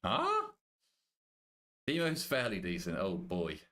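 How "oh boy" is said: usual speaking voice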